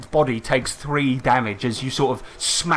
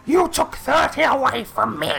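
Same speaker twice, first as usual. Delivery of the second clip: witch voice; 13 semitones higher than usual. Acted. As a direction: lord it over them like a hag. Witch protests.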